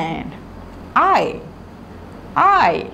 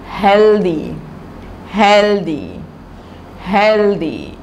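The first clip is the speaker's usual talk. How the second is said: put-on slow word-by-word reading for children, each word said thrice; slow reading drill; much slower than usual. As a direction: read it out slowly so the children can repeat. Teacher drills a reading lesson. (धीमे-धीमे बच्चों को पढ़ाने वाली आवाज़)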